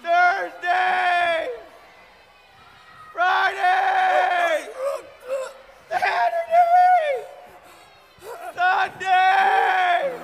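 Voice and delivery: loud, high shouted day names; shouting. Pretend. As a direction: call each day out with a pose. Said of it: "We're gonna do our Monday to Sunday".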